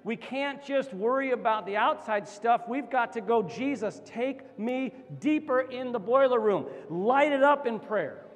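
high, loud, rallying exhortation; impassioned preacher's crescendo; full-force preaching. Real, not acted. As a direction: drive each phrase home like a rally cry.

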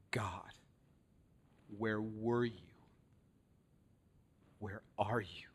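hushed, broken whisper to God; whispered prayer. Retold or imitated. Hurting believer asks God, hushed whisper. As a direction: whisper the question like a private prayer.

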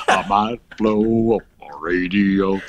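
low, slow, goofy drawl; mock dazed customer. Caller muttering to the rep, low slow goof. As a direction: drag the line out like a confused caller.

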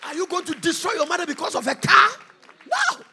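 high, loud sung preaching; fiery chanted sermon; full-force preaching. Real, not acted. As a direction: preach it out over the music.

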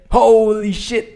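loud, slow, stunned outburst; shocked exclamation. Real, not acted. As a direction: drag it out in shock. Shocked about crash.

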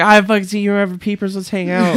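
high, loud, overeager buddy voice; mock Peepers voice. A podcaster voicing Peepers to Fabian, high and loud. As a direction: play a clingy friend, high and loud. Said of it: "Peepers comes in and he's like".

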